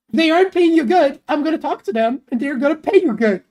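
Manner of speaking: loud, mock-threatening defence of a friend; mock protective. Streamer standing up for a friend's pay, loud and protective. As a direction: lay down the law, half playing.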